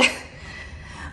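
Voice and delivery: soft breathy snicker; quiet laugh. Real, not acted. Vlogger showing off her new toilet, soft snicker. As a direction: snicker softly to yourself.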